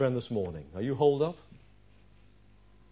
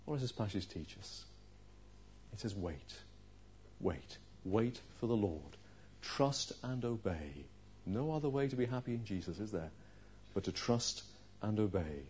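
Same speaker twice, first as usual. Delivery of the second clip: much softer, intimate sermon delivery; hushed preaching; 5 semitones lower, 10 dB quieter than usual. Acted. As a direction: lean in and plead gently.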